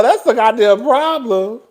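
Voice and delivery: ordinary delivery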